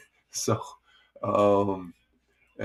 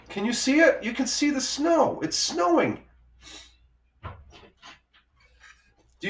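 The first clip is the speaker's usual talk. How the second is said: high, astonished exclamations about the snow; amazed at snow. Real, not acted. Spots snow falling outside.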